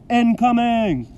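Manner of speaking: loud, high battlefield shout; yelled warning. A narrator's reading. Narrator likening the yell to an attack warning, shouted. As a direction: holler it like shells are falling. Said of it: "as if I'd just yelled".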